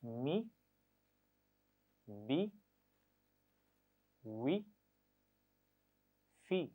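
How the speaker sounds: मी, बी, वी, फी said slowly one by one; syllables spaced out for repeating; sound drill. Shown as a demonstration. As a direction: model each syllable. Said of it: "मेरे बात पढ़िए".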